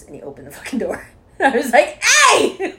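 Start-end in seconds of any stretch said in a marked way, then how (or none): 2.0-2.7 loud high yell with a laugh; startled yell. Retold or imitated. Retelling the bath story, a laughing high yell. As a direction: yell at the man who opened the door. Yelling at him through bathroom.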